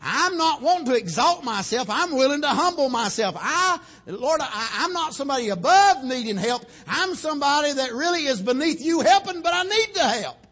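loud, high, pleading prayer at full force; heart cry. Acted. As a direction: cry out to God for help. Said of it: "that heart cry was saying".

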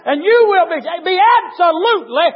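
high, loud, drawn-out pulpit shout; full-force preaching. Real, not acted. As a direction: shout the scripture slowly to the congregation.

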